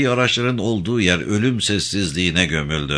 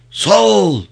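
loud, high troop shout; roared response; 12 semitones higher, 6 dB louder than usual. A narrator's reading. Group answering commander's salute, roaring. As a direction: bellow it back like soldiers on parade. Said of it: "tek bir ağızdan gürledi grup".

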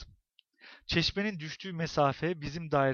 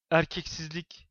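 ordinary delivery, nothing marked

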